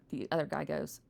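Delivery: ordinary delivery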